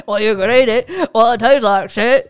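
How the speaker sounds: loud, high mock Aussie bushman voice; Crocodile Dundee impression. Mimicking the movie character.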